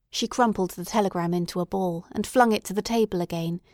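ordinary delivery

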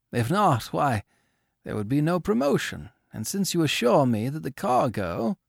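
put-on high, consoling older man; comforting old owner. A narrator's reading. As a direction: console the young man kindly.

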